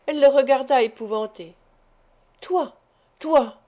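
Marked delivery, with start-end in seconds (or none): 2.4-3.6 higher-pitched outburst, growing louder; growing fury. A narrator's reading. He repeats it at the terrified woman with growing fury. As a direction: repeat it with mounting rage. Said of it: "répétait-il avec une fureur croissante".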